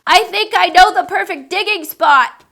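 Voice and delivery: high, loud eager call; shout over the wind. Acted. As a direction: yell to be heard over the storm. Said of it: "I shouted over the wind".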